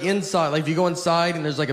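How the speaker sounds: ordinary talk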